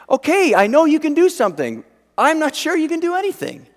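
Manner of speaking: high, pressed self-doubting voice; wavering belief. Acted. Believer torn between trust and doubt, wavering high. Acts out wavering human condition.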